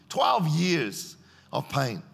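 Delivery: each word slowed and weighed; very slow, grave emphasis; slow and heavy. Real, not acted. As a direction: let twelve years of pain sink in. Preacher slows for weight.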